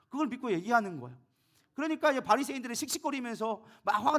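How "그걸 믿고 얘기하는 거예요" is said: usual speaking voice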